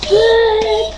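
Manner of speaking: lightly beeped swearing; mock censor beeps. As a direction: bleep the curses. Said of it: "they're done cursing now".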